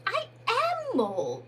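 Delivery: high, slow, irritated Mole voice; irritable. Acted. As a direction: Mole insists on who he is, put out. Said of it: "very irritably indeed".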